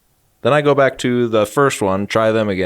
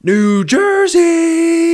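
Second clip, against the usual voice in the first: very high, slow stage yell; crowd callout; 4 dB louder, much slower than usual. Pretend. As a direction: drag it out to the arena. Imitating singer calling crowd.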